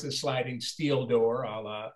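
ordinary talk, nothing marked

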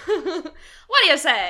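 slow Mid-Atlantic drawl with a laugh; Mid-Atlantic accent. Pretend. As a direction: laugh through an old Hollywood accent. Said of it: "the Mid-Atlantic accent".

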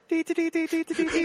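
high beeping teletype; teletype noise. Pretend. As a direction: chirp like a ticking teletype.

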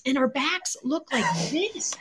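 hushed, voiceless mock amazement; whispered disbelief. Back patient marvelling at her own scans, whispered disbelief. Acted boast drops into whisper.

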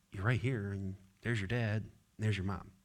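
soft, hushed reassurance; calm the little one down gently; quiet comfort to a scared child; soothing a toddler. Retold or imitated. Parent soothing frightened toddler.

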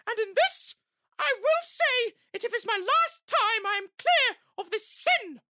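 a comic high put-on voice for a pleading accused woman; put-on accent. Shown as a demonstration. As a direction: squeak the whole plea way up high in a mock accent. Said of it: "try and do it".

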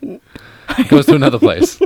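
laughing through the words; giggling while speaking. Real, not acted. Joking about wandering eye.

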